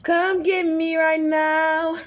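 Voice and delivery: high, loud, stretched-out holler; playful calling out. Pretend. Vlogger hollering to be fetched, playful and loud. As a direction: yell it high, dragging the words out.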